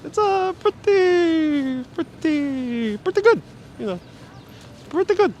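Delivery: very high, drawn-out singsong; high-pitched comic catchphrase delivery; Larry David impression. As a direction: stretch each word way up high. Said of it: "Larry David's impression".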